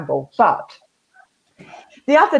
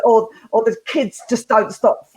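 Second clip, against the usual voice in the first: ordinary talk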